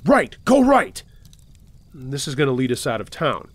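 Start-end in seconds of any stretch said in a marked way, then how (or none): 0.0-1.0 sharp, high-pitched loud shout; yelling. A narrator's reading. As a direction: yell urgent directions at the driver. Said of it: "and he yells".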